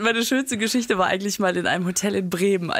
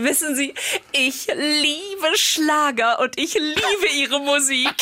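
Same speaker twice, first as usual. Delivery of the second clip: higher, slow, beaming declaration; a starstruck hotel director, slow and raised; beaming fan; 6 semitones higher, much slower than usual. Retold or imitated. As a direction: spread your arms and gush slowly.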